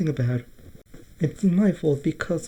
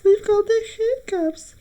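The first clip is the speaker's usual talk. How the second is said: very high childlike cartoon voice; squeaky little toy character; high-pitched toy character; 11 semitones higher, 5 dB quieter than usual.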